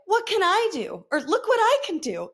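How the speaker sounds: put-on high-pitched sketch-comedy little boy; hammed-up high child catchphrase; Stewart. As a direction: show off in a high kid voice. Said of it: "he was always like".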